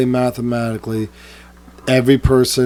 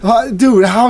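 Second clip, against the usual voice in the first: seriously pitched way up; mock disbelief; 8 semitones higher, slower than usual. Telling friends how he reacted, mock disbelief. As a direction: play it stunned and incredulous. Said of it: "I go".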